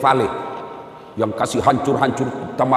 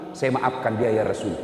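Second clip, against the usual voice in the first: soft forgiving; 4 semitones lower, 6 dB quieter than usual. Acted. Mother forgives her son.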